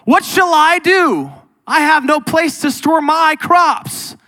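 hammed-up worried rich man; greedy rich man. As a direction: voice the rich fool anxiously wondering. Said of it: "He thought to himself".

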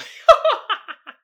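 high burst of laughter; loud laugh. Real, not acted. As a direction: crack up laughing. Laughing at own joke.

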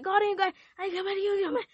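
high squeaky mock of God; squeaky teasing voice for God.

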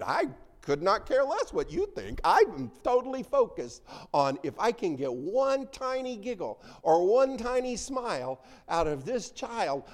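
put-on high, loud baby-talk grandparent voice; doting grandparent. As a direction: gush like a grandparent cooing at a baby.

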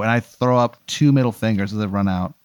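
ordinary talk, nothing marked

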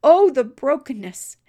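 emotional outcry. Real, not acted. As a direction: let the emotion burst out loud. Preacher's emotional climax.

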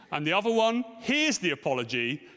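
ordinary talk, nothing marked

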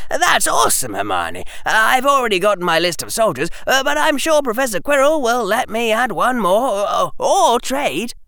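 put-on high, loud, gushing boy; suddenly enthusiastic. Harry welcoming Hermione to his army, enthusiastic. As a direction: tumble over the words with excitement. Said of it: "Harry's voice was suddenly enthusiastic".

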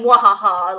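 loud hammy villain laugh; mustache twirling villain laugh. Retold or imitated. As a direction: cackle like a cartoon villain. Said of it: "no mustache twirling".

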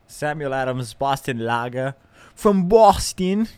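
bad Boston accent. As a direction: put on a poor Boston voice. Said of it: "That wasn't a very good Boston voice".

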